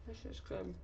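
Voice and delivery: mouth full. Real, not acted. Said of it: "Excuse my chewing".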